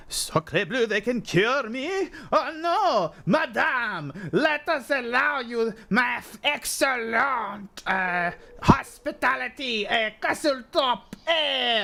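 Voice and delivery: high, slow French Canadian candelabra; hammed-up French Canadian accent. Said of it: "really getting offensive for French Canadians".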